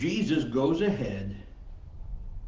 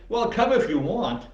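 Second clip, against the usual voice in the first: high, louder, take-it-or-leave-it offer; mock-casual invitation, pitched up; 8 semitones higher, 5 dB louder than usual. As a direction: toss it out as optional. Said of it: "Jesus didn't say".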